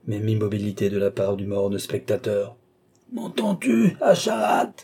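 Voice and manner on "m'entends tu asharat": very high, strained vociferation; throat-tearing yell. A narrator's reading. Enraged old man screams at motionless Asharat, throat-tearing. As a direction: tear your throat calling him. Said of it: "vociféra le vieillard en déchirant son gosier".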